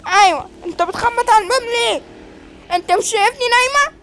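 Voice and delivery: high-pitched put-on voice of a woman woken up; scolding woman. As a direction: scold the knocker in a high, sustained voice. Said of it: "انت بتزعقي ليه؟". (ست بتزعق بصوت رفيع)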